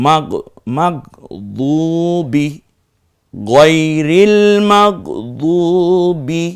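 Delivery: slow recitation, holding "দু" in "মাগদুবি" one alif long; one-alif elongation. Shown as a demonstration. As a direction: draw out the "দু" in "মাগদুবি". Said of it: "একালিপ টেনে বলবো". ("মাগদুবি"-র "দু" এক আলিফ টেনে)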